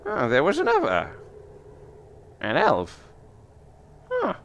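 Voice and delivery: high, musing crone voice; old seer woman. Acted. Old seer picks up another bone, high musing crone voice. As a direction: muse up high, pausing between the finds. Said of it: "and she says".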